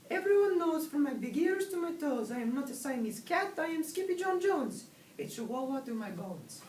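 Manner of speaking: high-pitched, insistent kitten voice; defiant kitten. A narrator's reading. Kitten insists he is a chihuahua, high defiant declaration. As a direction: proclaim it in a squeaky little voice.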